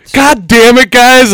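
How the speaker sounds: loud, high-pitched exasperated cry; exasperated outburst. Real, not acted. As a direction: blow up at your buddies. Outburst over the drawn punishment.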